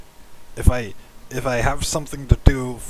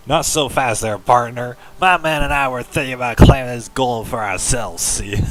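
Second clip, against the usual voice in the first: put-on cowboy accent; 6 dB louder than usual. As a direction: bellow it like a Western cowboy. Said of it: "Everyone talks like a cowboy".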